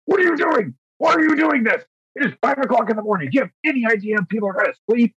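high, fast scolding parent voice; disappointed parent. Retold or imitated. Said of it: "sounding like a very disappointed parent, like, chastising their child".

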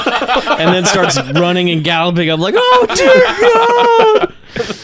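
high, laughing, near tears; laughing and teary. Retold or imitated. Retelling crying at dog scene.

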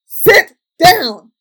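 retold command. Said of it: "And I'm like".

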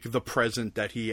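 ordinary talk

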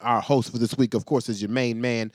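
upbeat podcast host intro; announcer. Acted. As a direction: hype up the show opening.

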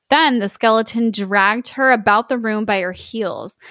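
plain reading voice

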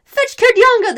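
very high, loud call; shouted order. A narrator's reading. Crowd sending for the elected man, shouted. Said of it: "they shouted".

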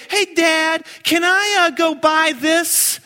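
a hint of a begging kid; kid asking. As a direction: beg dad like a child. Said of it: "When my kids say".

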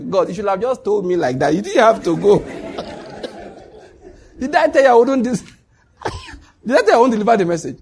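high, loud, amused complaint; laughing mock protest. Prophet complaining to God about the message, laughing protest. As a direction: protest through bursts of laughter.

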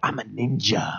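low, hushed mock ninja line. Comedian acting out the nun punch, hushed mock ninja. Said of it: "you just go".

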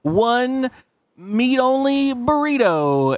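high, slow read-back of the order; a high, drawn-out server reading the order back. Retold or imitated.

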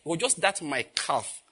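usual speaking voice

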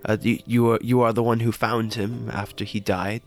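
normal talking voice

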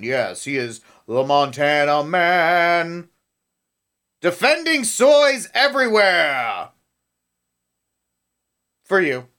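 slow, booming movie trailer voice; mock superhero intro. Mocking the Montana man, booming trailer voice. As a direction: announce him like a comic book hero.

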